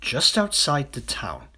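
usual speaking voice

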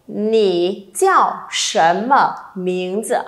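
very slow, stretched question; slow read-along. Shown as a demonstration. Teacher reading with the class, very slowly. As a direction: draw every syllable out for learners. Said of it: "Đọc với cô nào".

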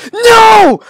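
high, loud scream of NO; frustrated scream. Real, not acted. Gamer failing the jump, frustrated scream. Fails in rage game.

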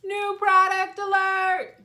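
hammed-up announcement of a new product; announcer call. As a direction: shout it like a game-show announcer.

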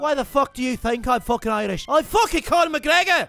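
indignant Irish fighter; Conor McGregor. Acted. Insisting who he is to a sceptic, indignant Irish accent. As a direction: declare it crossly in an Irish brogue.

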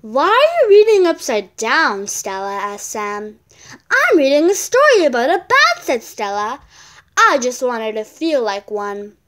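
loud, lively read-aloud voice for a picture book; bright storybook reading; reading a story aloud. Acted. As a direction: read it out to the class with energy. Child reads story aloud.

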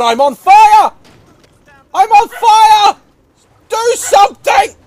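high, loud cries for help; panicked shouting. Pretend. Giraffe on fire begging for help, panicked shouting. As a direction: shout urgently, pitch climbing.

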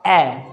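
ए with a wide-open mouth, as in cat; open-mouthed vowel. Shown as a demonstration. Teacher repeating the vowel for students, open-mouthed. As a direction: drop the jaw fully for ए. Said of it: "मुंह पूरा खोलकर बोलना".

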